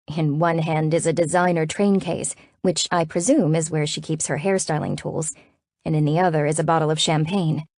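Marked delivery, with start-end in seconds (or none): none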